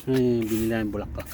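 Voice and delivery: ordinary talk, nothing marked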